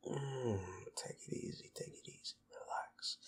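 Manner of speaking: hushed, breathy whisper close to the ear. Acted. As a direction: soothe them in a barely voiced whisper. Soothing partner in an intimate moment.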